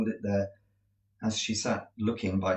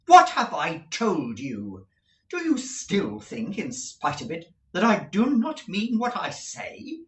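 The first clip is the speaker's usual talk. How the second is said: louder and slow at the start, sharply pressing; rather angry; 8 semitones higher, 5 dB louder than usual. A narrator's reading. Estella to Pip, a touch angry and impatient. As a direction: snap back at him, impatient. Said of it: "again rather angrily".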